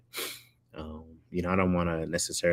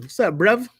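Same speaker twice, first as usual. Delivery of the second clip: high, punchy mock London accent; London slang accent; 12 semitones higher, 6 dB louder than usual. Showing off slang from England, mock London accent. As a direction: put on exaggerated British street slang. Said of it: "Negro slang in, uh, England".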